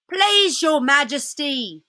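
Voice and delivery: high, deferential fox voice; pleading old fox. Acted.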